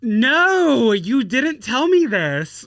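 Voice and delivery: high, loud, drawn-out disbelief; real shock. Real, not acted. Co-host hearing of the run-over foot, shocked. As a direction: react aghast, stretching the words out.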